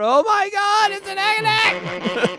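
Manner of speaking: high, loud panicked cry; shocked. Real, not acted. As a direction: yelp it out in shock. Panicked apology to Eric.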